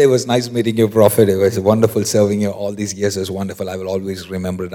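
low, rapid run-on goodbye; hurried farewell. Acted. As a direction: rattle off polite praise while eager to get away. Servant's hasty farewell before fleeing.

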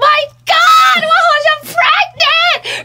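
high, loud shriek with drawn-out words; excited scream. Retold or imitated. Waking her parents with pregnancy news, excited scream.

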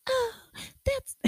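very high-pitched put-on jolly voice. Host showing off a Christmas voice, jolly and high. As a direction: pipe up in a mock-jolly squeal. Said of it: "that's my fucking jolly voice".

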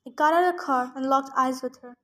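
usual speaking voice

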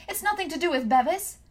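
high, loud shriek; girl shrieking to take the blame. A narrator's reading. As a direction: shriek the confession. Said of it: "she shrieked".

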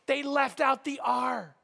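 high, loud mock wailing; crying. The Pope laments a copying error to Peter, mock crying. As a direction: wail it like a hammed-up sobber. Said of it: "and, and he's crying hard".

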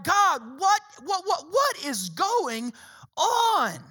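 exasperated, high plea with drawn-out words. Acted. Preacher voicing a worn-out believer to God, exasperated.

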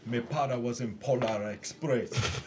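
hammed-up Jamaican accent impression. Mocking Tom Hanks' son to friends, put-on Jamaican accent. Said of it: "with a Jamaican accent".

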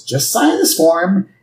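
scheming villain offer breaking into a laugh; cartoon villain with evil laugh; Mr. Burns. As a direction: lure them in, then cackle. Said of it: "And then he says".

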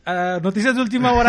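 loud, high mock news anchor; news announcer.

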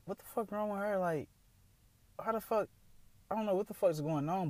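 high, mock-baffled rant; incredulous outburst. Retelling reaction to crying friend.

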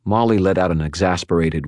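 ordinary delivery

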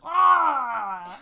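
high shouted Ah; a sharp acted yell of pain; loud mock scream of a wounded hero; reenacted scream. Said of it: "and he goes".

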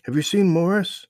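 put-on high, loud friendly boy; young boy voice. A narrator's reading. Strange boy greets the lost children, high friendly voice. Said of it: "a voice said".

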